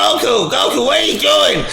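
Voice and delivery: a telepathic voice calling his name; voice in Goku's head. Acted. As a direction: call out to Goku from afar. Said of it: "He's like".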